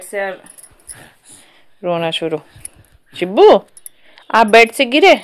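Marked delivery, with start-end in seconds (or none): 3.2-5.2 calling out. Real, not acted. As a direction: rush in calling her name. Baby just fell off bed.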